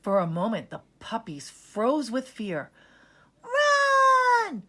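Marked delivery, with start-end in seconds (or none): 3.4-4.6 very high, loud panicked shout; frightened cry. Acted. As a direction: yell it to save the others. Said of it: "Sheba cried".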